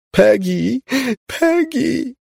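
very high, drawn-out calls; screaming. A narrator's reading. Mother runs toward the figure calling Peggy, screaming. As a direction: scream the name across a distance. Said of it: "krzyczała mama".

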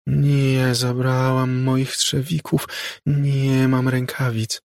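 put-on high, slow, otherworldly child ghost; ghost girl's voice. A narrator's reading. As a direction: drift in and out like a far radio. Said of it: "głosem, który zamierał i powracał".

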